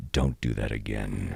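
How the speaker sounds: very low, slow and quiet; low warning. Retold or imitated. Front desk agent warning a rude guest, quiet menace. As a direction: lay down a calm, veiled threat.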